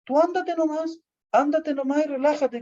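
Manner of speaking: hammed-up tragic high voice; theatrical martyr. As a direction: overact the wounded lover pushing him away. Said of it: "eran unas actuaciones".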